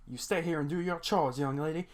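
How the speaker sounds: lightly voiced strict old frog scolding; stern grandpa. Hop Pop voiced scolding Anne.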